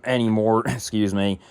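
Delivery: ordinary talk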